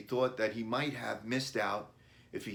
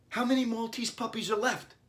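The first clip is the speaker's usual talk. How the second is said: put-on high, excited boy's voice; bright child voice, pitched well up; eager child Billy; 13 semitones higher, 5 dB louder than usual. A narrator's reading. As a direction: ask like an eager little boy.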